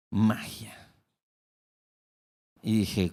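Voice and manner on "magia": whispered word. Retold or imitated. Ends reflective quote.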